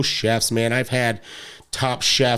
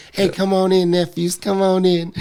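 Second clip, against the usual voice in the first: high, welcoming rapper impression; 8 semitones higher than usual. Rapper waves his nephews in, high welcoming impression. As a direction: imitate a friendly rapper uncle, pitched up. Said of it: "he's just so funny, so nice".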